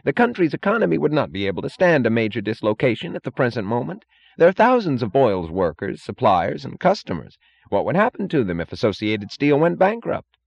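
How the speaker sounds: high-pitched pleading; Holloway. A narrator's reading. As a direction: implore him to consider the others. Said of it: "said Holloway pleadingly".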